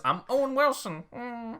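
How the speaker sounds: high-pitched jokey actor impression; Owen Wilson. Showing off an impression to co-host, high comic voice. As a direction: pitch it way up, end on a little hum.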